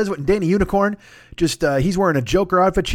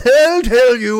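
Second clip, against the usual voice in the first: loud, high, drawn-out character voice; put-on comic voice; 7 semitones higher, 6 dB louder, much slower than usual. Shown as a demonstration. Podcaster briefly redoing the voice that clogged his head, put-on and loud. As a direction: stretch it out in a silly strained voice. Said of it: "doing that fucking voice".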